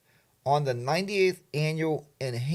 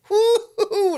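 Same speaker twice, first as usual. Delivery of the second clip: high woo-hoo shiver; 7 dB louder, slower than usual. Pretend. Mock shivering cry.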